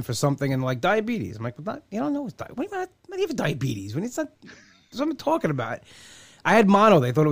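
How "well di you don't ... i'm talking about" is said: higher, softer, baffled mock-questioning voice; mock confused question. Comic to cohost about doctors, higher baffled mock voice. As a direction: play the puzzled doctor who doesn't get it.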